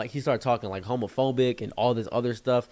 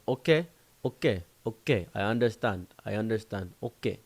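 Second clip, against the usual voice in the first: put-on African accent. African friend accepting the explanation, African accent. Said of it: "My African friend explained to me".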